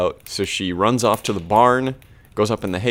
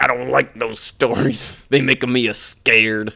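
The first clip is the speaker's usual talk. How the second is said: Stanley; 2 semitones higher than usual. Retold or imitated. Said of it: "Stanley's like".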